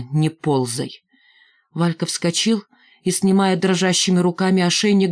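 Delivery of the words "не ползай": low-pitched, understated contempt; disgusted. A narrator's reading. Миронова to crawling Валька, disgusted. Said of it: "брезгливо сказала Миронова".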